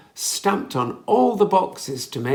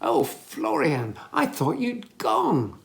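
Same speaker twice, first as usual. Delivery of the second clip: slow, relieved exclamation, rising high; a mild cry of relief on finding him; gently voiced relief; 7 semitones higher than usual. A narrator's reading. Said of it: "cried Millie".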